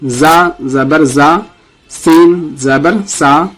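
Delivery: slow, deliberate letter-by-letter spelling; slow recitation drill. Shown as a demonstration. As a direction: spell each letter for students to repeat. Teacher leads letter recitation drill. (آہستہ قاعدہ پڑھانا)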